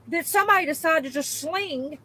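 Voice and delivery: usual speaking voice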